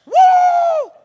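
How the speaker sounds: high, loud sustained "woo"; hyped-up whoop. Acted. Preacher imitating five-hour energy drink rush, wild whoop. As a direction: let out a mock energy-rush shriek.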